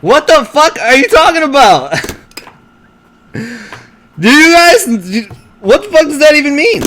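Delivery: high voice, between laughs; baffled and cracking up; incredulous. Real, not acted. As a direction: demand an answer through real amusement. Cracking up at friend's baffling insult.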